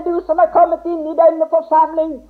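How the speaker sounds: ordinary talk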